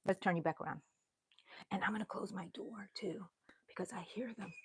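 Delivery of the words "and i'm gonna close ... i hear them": hushed whisper, fast at first. Real, not acted. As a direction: whisper so nobody hears. Hiding from family behind door.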